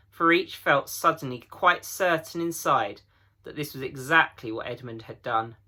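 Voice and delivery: usual speaking voice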